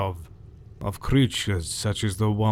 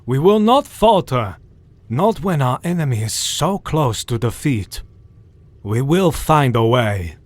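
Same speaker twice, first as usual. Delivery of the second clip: rally them with fierce resolve; defiant shout; 3 semitones higher, 6 dB louder than usual. Acted. Van Helsing rallying the hunters, loud and defiant.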